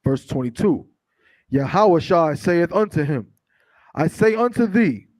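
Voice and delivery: slow, measured reading aloud; scripture reading. Acted. Reader giving the congregation verses from Matthew, slow and declamatory. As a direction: read it out deliberately, word by word.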